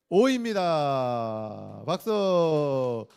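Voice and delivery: loud, long drawn-out announcement; game-show reveal. Real, not acted. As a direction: stretch it out like a quiz host. Quiz host reveals the answer.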